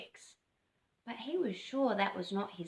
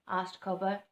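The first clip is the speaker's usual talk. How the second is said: ordinary delivery, nothing marked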